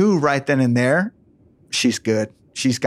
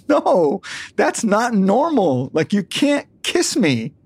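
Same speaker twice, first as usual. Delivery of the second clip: higher, slow mock-offended girl's voice; imitating daughter refusing; 8 semitones higher, slower than usual. Retelling Victoria refusing her father, high slow mock disgust. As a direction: draw it out like a disgusted teen. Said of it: "And she's like".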